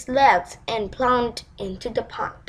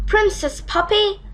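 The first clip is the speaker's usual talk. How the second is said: croaking frog; 8 semitones higher than usual. Acted. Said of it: "he croaked".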